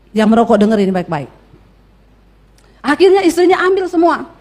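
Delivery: ordinary talk, nothing marked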